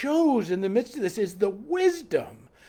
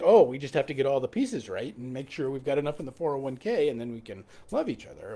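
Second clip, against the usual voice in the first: mocking planner; 7 semitones lower, 5 dB quieter, faster than usual. Preacher mocks the retirement-savings planner, low and fast. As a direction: lower and softer, fast sarcastic aside.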